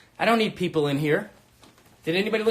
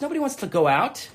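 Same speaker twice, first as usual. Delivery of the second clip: ordinary talk, nothing marked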